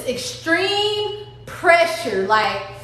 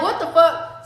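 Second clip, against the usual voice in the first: ordinary talk, nothing marked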